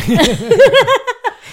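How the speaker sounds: high burst of loud laughing; loud laughter. Real, not acted. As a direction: crack up out loud. Group laughing at the zoo map.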